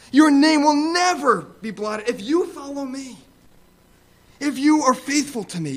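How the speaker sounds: voicing Jesus's guarantee with fervour; fervent promise. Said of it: "what the Lord Jesus Christ is saying is".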